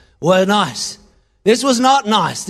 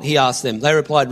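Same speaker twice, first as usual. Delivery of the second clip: normal talking voice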